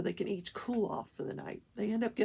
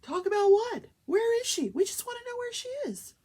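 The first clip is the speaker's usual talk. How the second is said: loud, high-pitched outraged questioning; acting out frantic, indignant pleading; exasperated demand; 13 semitones higher, 7 dB louder than usual. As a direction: demand answers, voice shooting up. Acted demand to the lawyer.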